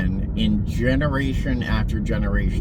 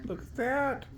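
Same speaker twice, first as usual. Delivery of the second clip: high soft cooing; 13 semitones higher, 11 dB quieter than usual. Real, not acted. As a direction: coo softly at a pet. Cooing at a pet.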